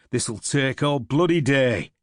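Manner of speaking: a loud, irritated grumble over the digging; grumbling. Acted. Tired soldier grumbles at the digging, loud and irritated. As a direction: complain at the hard ground. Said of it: "he grumbled".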